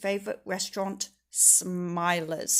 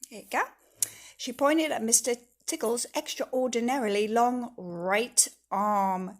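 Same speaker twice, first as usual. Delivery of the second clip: plain reading voice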